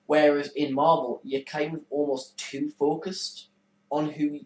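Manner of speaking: ordinary talk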